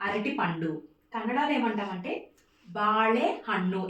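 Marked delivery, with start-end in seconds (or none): 2.8-3.8 బాళే హన్ను slowly, with ళ curled back; slow, careful model of బాళే హన్ను; retroflex ళ. Shown as a demonstration. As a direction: draw out బాళే, tongue back on the l. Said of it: "అలా పలకాలి".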